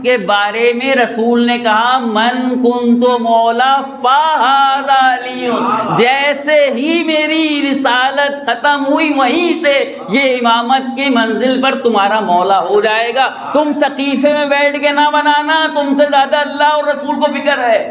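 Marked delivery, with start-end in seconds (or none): 2.0-5.5 very slow, every word drawn out; slow solemn quote. Acted. Preacher quotes the Prophet slowly. 6.0-17.9 higher, building to a shout on سقیفے; fiery preaching. Acted. Preaching at full force.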